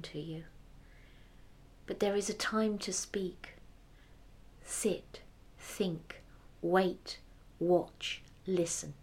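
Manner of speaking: whispered slow advice; soft wise whisper. Acted. The prince counsels the hunter in a slow whisper. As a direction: breathe the wisdom gently.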